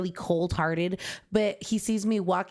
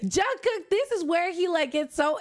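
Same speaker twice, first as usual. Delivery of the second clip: gushing; 8 semitones higher than usual. Real, not acted. Gushing over Jin.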